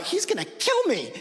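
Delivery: terrified. Retold or imitated. Terrified thought as dad charges.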